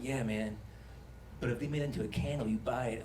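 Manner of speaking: low, soft tempting inner voice; a hint of a sly inner tempter; inner demon voice. The demon voice in his head, low and softly tempting. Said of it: "one voice is like".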